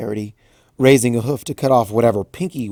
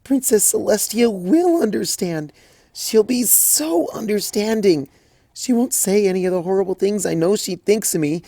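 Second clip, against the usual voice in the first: tearful Twilight; 11 semitones higher, 4 dB louder than usual. Acted. Twilight breaking down to her friends, high tearful voice. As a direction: let it crack and fall apart.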